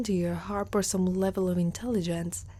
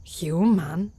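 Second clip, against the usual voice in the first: usual speaking voice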